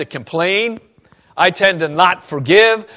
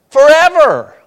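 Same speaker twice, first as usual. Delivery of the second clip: emphatic; 12 semitones higher, 11 dB louder than usual. Real, not acted. Preacher answers his own question.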